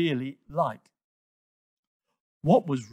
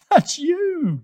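high, loud giggly reply; teasing, giggly and high; giggling; 14 semitones higher, 7 dB louder than usual. A narrator's reading. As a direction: giggle it out, much higher. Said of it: "giggled Toto".

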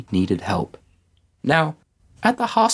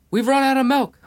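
very high-pitched, mock-desperate outburst; frantic blurting. A narrator's reading. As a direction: blurt it out like a panicked, overtired parent. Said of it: "so tired ... hardly making sense".

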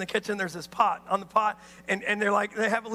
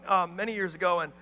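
ordinary delivery

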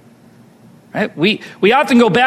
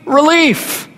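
desperate plea; 6 semitones higher, slower than usual. Retold or imitated. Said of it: "all you're praying for is".

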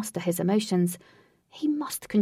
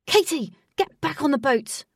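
firm loud command; ordering; 6 semitones higher, 4 dB louder than usual. Acted. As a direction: call her back sharply. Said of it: "ordered Wraith".

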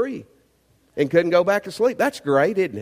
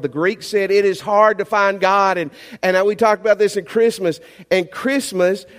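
full-force preaching; 2 semitones higher, 4 dB louder than usual. Acted. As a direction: preach it out, pleading with the room.

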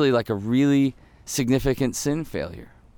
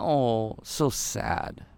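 mock pity; 4 semitones lower, 6 dB quieter, much slower than usual. Mocking shallow puppy-like pity.

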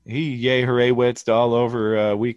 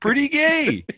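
very high, louder teasing voice; squeaky tease; 5 dB louder than usual. Pretend. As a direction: squeak it out to mock a friend. Teasing the winner.

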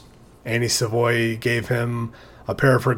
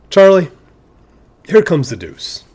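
sudden loud high call of the name; 5 semitones higher, 5 dB louder than usual. Retold or imitated. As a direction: shout the name across the field. Calling to the umpire.

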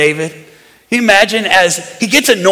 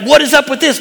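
higher-pitched mock complaint; mock attitude; 6 semitones higher than usual. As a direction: protest with put-on attitude. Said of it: "could have had an attitude like".

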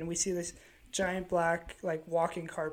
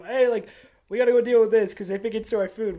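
loud raised call to the crew; yelling to the crew; 4 semitones higher, 8 dB louder than usual. Retold or imitated. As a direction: shout the warning across.

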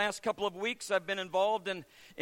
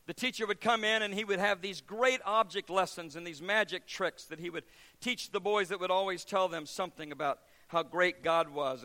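ordinary talk

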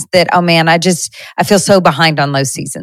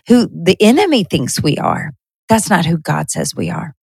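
normal talking voice

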